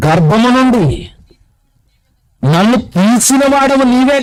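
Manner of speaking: high, slow declaimed Bible verse; scripture reading. Acted. Preacher reading a psalm to the congregation, declamatory.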